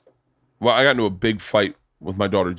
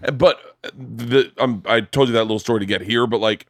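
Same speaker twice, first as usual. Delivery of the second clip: ordinary delivery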